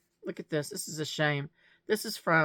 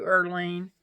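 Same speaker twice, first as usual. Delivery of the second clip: normal talking voice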